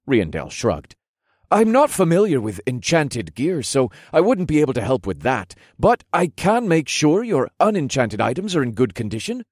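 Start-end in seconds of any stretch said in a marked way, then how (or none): 1.5-9.4 put-on high, eager young officer; chipper lieutenant, helpful. A narrator's reading. Lieutenant offering to look after the hero's gear, eager. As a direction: offer help in a lifted, lively voice.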